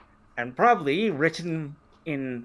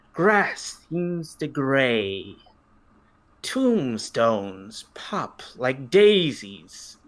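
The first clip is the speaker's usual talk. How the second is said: narrator voice; 4 semitones lower than usual. Acted. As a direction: read it like a gothic tale. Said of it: "Let me get my best narrator voice".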